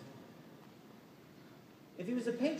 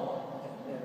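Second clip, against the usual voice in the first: usual speaking voice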